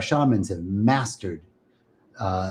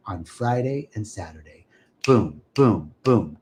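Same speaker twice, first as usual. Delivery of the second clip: ordinary talk, nothing marked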